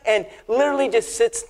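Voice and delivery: ordinary delivery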